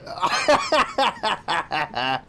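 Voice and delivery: low rapid cackle; laughing. Real, not acted. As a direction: cackle after boasting. Laughs at the enemy.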